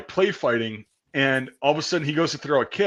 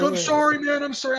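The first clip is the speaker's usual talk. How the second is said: much higher, pleading apology; imitating the friend's apology; 14 semitones higher than usual. Friend apologizing after breaking his nose, high pleading voice. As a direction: squeak out a sheepish sorry. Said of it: "He's like".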